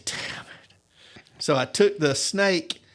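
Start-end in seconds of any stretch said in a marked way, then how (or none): 0.0-1.2 a voiceless, disgusted aside; whispered curse. Retold or imitated. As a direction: breathe the curse under your breath. Swearing to himself, disgusted.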